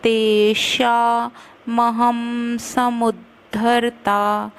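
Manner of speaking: careful Sanskrit chant; slow verse recitation. Shown as a demonstration. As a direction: recite the line slowly and evenly. Recites verse line slowly.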